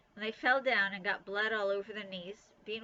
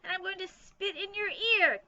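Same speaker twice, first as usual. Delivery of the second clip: high, loud spooky howl; spooky ghost howl; 11 semitones higher, 6 dB louder than usual. A narrator's reading. Bean keeps haunting to scare her sister Nancy, spooky howl. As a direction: moan the threat like a phantom. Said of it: "she howled in a spooky voice".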